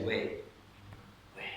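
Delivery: "Weh" sighed out on the exhale; breathy "Weh". Shown as a demonstration. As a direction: let "Weh" be only breath. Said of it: "I want you to say".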